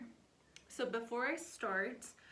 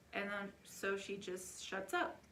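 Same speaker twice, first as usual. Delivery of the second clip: normal talking voice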